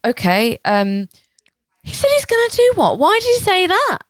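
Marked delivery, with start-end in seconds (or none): 1.8-4.0 high-pitched bewildered questions; mock-horrified incredulity; appalled disbelief. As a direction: react aghast, voice shooting up. Acting the target reading the poem.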